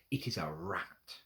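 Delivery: plain reading voice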